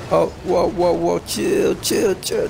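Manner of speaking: high, hurried pleading; playful panic. Real, not acted. Gamer begging rivals to back off, high panicked pleading. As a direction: repeat it high as the attack comes.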